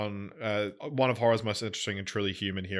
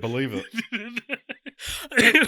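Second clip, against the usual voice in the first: laughing; 8 dB louder, slower than usual. Real, not acted. Mocking the line reading.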